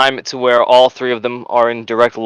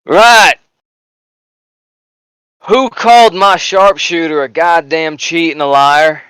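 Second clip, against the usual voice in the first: angry sergeant; 3 semitones higher, slower than usual. Acted. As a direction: bark out the question, demanding an answer. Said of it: "He goes".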